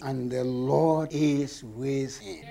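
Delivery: a softly read verse, held low; hushed reverent reading. Shown as a demonstration. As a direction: let the verse settle quietly. Scripture read as key line.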